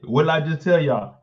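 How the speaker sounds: ordinary talk, nothing marked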